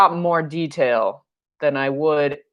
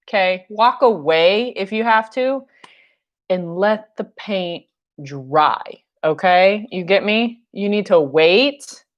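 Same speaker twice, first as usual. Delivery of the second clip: ordinary talk, nothing marked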